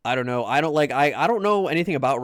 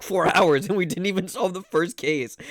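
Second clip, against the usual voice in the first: real laugh breaking into the words; laughing in disbelief; 4 semitones higher than usual. Real, not acted. Streamer admitting the first case went unsolved, laughing. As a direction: laugh through the admission. Laughs at own slow progress.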